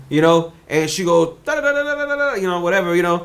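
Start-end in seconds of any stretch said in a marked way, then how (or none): 1.5-2.3 high, sing-song babble of a strange tongue; pitched-up nonsense syllables; mock foreign gibberish. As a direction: mimic a head chattering in an unknown language. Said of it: "this other language".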